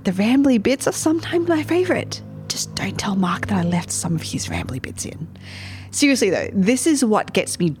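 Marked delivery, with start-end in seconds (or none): 2.5-5.3 whisper. Real, not acted. Conspiratorial aside about host Mark.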